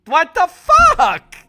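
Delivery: slow, loud, high disbelief; shocked what. Real, not acted. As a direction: player stunned by result, drawn-out shocked outburst. Shocked at the step result.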